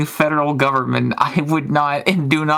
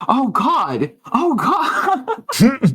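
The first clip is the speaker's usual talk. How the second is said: higher, laughing oh God; half-laughing dismay; mock-horrified groan breaking into laughter; laughing mock horror; 9 semitones higher, much slower than usual. Reacting to a story in mock horror.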